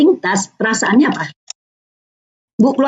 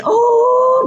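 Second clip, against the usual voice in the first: surprised oh; 12 semitones higher than usual. Acted. Mother responding to child.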